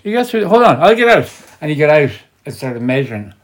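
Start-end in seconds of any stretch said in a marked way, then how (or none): 0.0-1.3 dad. Retold or imitated. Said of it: "And Dad said".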